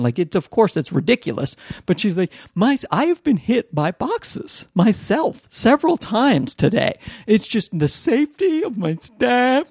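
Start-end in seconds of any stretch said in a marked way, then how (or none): 2.6-9.7 slow, high, hammed-up distress; mock freaking out. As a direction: whine as an overdramatic store manager. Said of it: "she starts, like, freaking out".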